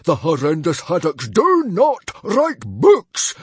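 high, loud, slow decree; fuming. Acted. Father laying down family law, fuming. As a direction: thunder it word by word. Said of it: "fumed Stoic the Vast".

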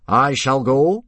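volunteer like the hero of the hour; noble and dignified. Acted. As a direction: Gobber loudly volunteering to face the dragon. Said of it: "trying to sound noble and dignified".

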